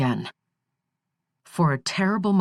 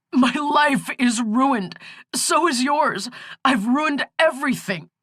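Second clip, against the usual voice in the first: despairing outpouring; 8 semitones higher than usual. Acted. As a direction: let it all pour out. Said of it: "was pouring out".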